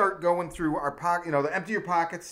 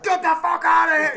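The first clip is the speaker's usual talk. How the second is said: shouted, much higher and louder; angry yell; 12 semitones higher, 9 dB louder, faster than usual. Retold or imitated. Cop yelling at the kids to get lost. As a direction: yell them off the property. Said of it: "he's like".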